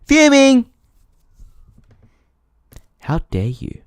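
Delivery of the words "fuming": very high, loud yelp of Fuming; hammed-up furious squeal; mock shriek. Shown as a demonstration. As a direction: squeal the word like a tantrum. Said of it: "I would be fuming".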